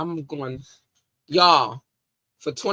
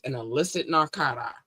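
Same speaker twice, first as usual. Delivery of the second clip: ordinary delivery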